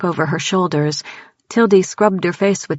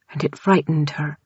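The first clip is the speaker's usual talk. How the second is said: usual speaking voice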